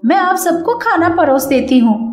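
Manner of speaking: Kavita's voice. Acted.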